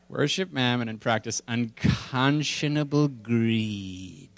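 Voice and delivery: slow, drawn-out mock relish; savoring relish. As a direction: linger over every word to the end. Savouring repeat of the cartoon line.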